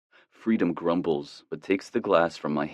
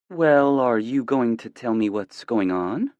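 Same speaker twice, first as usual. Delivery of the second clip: higher mom voice, probing; curious mother; 6 semitones higher, 5 dB louder than usual. A narrator's reading. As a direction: press your son for news.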